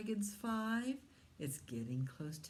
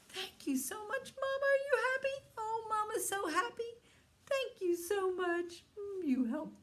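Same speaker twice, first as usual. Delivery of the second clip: much higher, gushing thanks; delighted mama; 13 semitones higher than usual. Acted. Happy mama dragon thanks the child for helping. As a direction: gush with joy.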